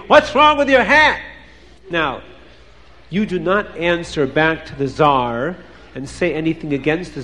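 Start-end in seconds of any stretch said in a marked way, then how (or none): 0.1-1.2 high, raised-voice demand; screaming. A narrator's reading. Tsar screaming at a soldier about his hat, high yell. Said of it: "But the Tsar is screaming".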